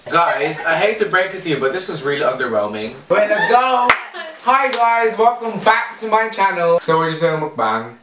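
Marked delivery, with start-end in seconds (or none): 0.1-6.8 upbeat vlogger intro; bright hyped welcome; excited. Acted. Vlog intro greeting.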